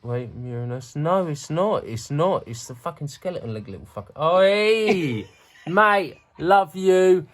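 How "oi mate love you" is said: high, loud cheering at a mate; affectionate shout. Real, not acted. Thanking his mate for the gift, a loud happy shout. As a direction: yell your love across the room.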